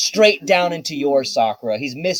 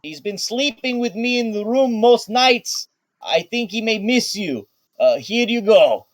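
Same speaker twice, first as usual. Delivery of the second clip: Baco calling; 8 semitones higher than usual. Acted. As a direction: call out brightly to Miss Sakura. GM voices Baco handing dog.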